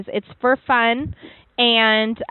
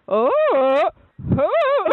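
high, drawn-out mock hooting; imitated Grawp hoot; 7 semitones higher than usual. Host imitating a funny voicemail character, hammed-up hooting. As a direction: hoot like a caller imitating a giant. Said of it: "somebody actually did Garp".

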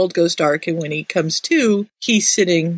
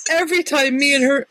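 normal talking voice

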